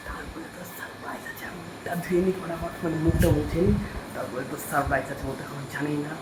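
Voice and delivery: hushed whisper so she can't hear. Real, not acted. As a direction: whisper it, keep the surprise secret. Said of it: "আমি আস্তে আস্তে কথা বলছি".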